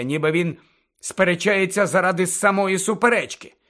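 plain reading voice

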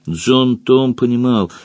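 ordinary delivery

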